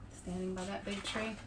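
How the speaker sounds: usual speaking voice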